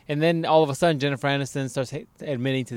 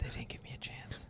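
whispering; 21 dB quieter than usual. Real, not acted. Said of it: "Why are you whispering?".

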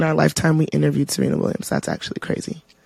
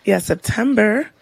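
ordinary talk

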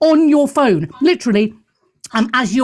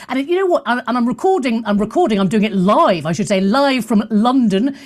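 ordinary talk, nothing marked

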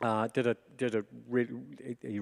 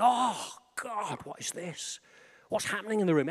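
awed inner cry; 9 semitones higher than usual. Retold or imitated. Acts out his awed inner cry.

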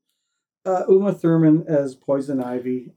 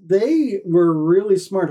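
ordinary talk

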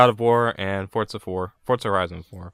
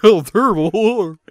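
higher, louder and slow, a drawn-out mock voice; mocking imitation; 10 semitones higher, 8 dB louder, much slower than usual. Teasing a friend by imitating his odd delivery, hammed-up and drawn-out. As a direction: ham up a friend's accidental impression. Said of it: "You sound like Billy imitating somebody".

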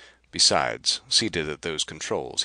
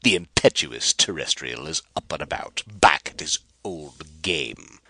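mocking; 7 dB louder than usual. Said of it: "grinning mockingly at the pair".

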